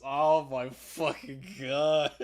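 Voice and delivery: real slow, laughed-out words; laughing. Real, not acted. Gamer cracking up at the accent, laughing. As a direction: drag the words out through laughter.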